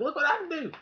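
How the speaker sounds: bragging to a friend about a new skill, high excited voice; proud show-off. Retold or imitated. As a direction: squeal it like a kid proud of a trick. Showing off to friend.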